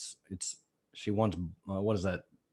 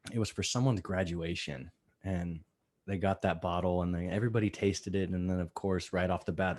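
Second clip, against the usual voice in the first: usual speaking voice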